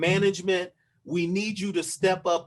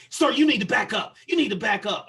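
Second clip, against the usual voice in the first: loud, raised mock command; retold police order; 3 semitones higher, 6 dB louder than usual. Officer to the man explaining with his hands, loud retold order. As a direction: order someone back, louder and firm.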